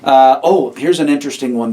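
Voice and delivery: ordinary talk, nothing marked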